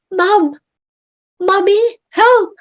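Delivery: put-on very high, loud child's scream for mum. Child in the freezing water screaming for mummy.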